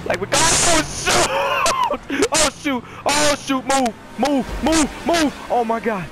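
high, panicky gamer yelling; frantic excitement. Real, not acted. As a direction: cry out high as cars fly past. Panics amid crashing cars.

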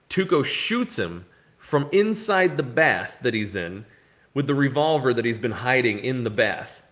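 ordinary talk

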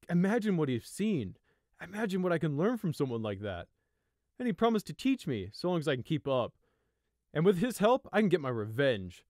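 put-on loud, admiring young stag; awed enthusiasm. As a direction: gush about his hero. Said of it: "gleam of awe".

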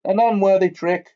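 loud, high, furious outburst; furious shout. A narrator's reading. Professor scolding students, shouting in utmost fury. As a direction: shout it at the cheating players. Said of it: "expression of the utmost fury".

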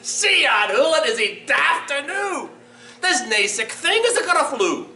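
high, incredulous scoff in Scots; gleeful, high-pitched Scots mouse; mocking mouse. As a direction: scoff merrily once the toad has gone. Mouse mocks fleeing owl in story.

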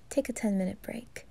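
hushed suggestion; whisper. Acted. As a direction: let it fall to a whisper. Whispered aside to listener.

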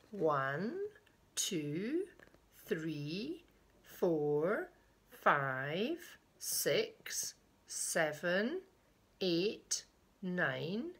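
slow count, each number swooping up; singsong counting; hide-and-seek counting. Acted. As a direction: pause between numbers, lifting each at the end.

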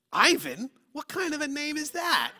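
put-on high, loud child's voice, puzzled by the name; incredulous kid. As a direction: play a blunt five-year-old asking.

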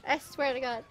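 low, holding back tears. Real, not acted. Streamer insisting to chat she is not crying, low and teary. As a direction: deny the tears while they press in. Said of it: "I am not crying".

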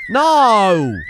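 loud high no of dismay; anguished shout. Real, not acted. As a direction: yell at the screen as it goes wrong. Character climbs through unwanted.

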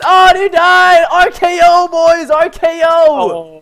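loud, slow triumphant call; victory shout. Real, not acted. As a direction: drag out the win call.

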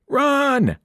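a sharp, high yell to flee; screamed. A narrator's reading. Boy yelling at friends to flee, screaming. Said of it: "I screamed at Annabeth and Grover".

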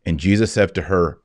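usual speaking voice

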